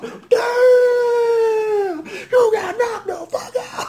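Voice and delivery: high, slow yell through laughter; laughing shout. Retold or imitated. Reliving Debo's uppercut with a high laughing yell. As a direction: roast the knocked-out guy.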